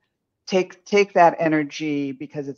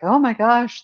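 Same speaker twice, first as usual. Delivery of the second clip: high, louder burst of dismay; mock-shocked outcry, pitched well up; alarmed exclamation; 9 semitones higher, 5 dB louder than usual. Reader voices client facing a department transfer, startled dismay.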